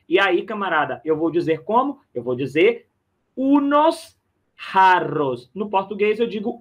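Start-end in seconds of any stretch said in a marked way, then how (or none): 3.3-5.5 unos jarros said very slowly, the J breathed out like an H; drawn-out Spanish unos jarros, J as a breathy H; slow teaching demo. Shown as a demonstration. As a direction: spell it out slowly for the class. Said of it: "Atenção pra essa escrita e pra essa pronúncia".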